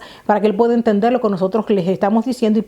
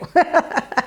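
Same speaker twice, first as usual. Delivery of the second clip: short, higher-pitched chuckle; self-amused laugh; 7 semitones higher than usual. Real, not acted. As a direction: laugh off your own claim. Laughs after doubting her claim.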